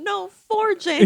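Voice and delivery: high, loud, slow chant; mock Swiper no swiping. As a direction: scold it like a kids' show. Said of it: "it's like Sauron".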